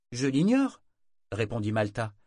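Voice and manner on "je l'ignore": high, louder, pert denial; réponse défensive. A narrator's reading. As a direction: toss back the answer. Malta répond, sur la défensive. (dénégation aiguë et effrontée)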